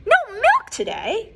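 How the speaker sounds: high, loud shocked question; cried out. Acted. Farmer Brown cries out at the cows' strike. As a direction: cry out in disbelief. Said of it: "cried Farmer Brown".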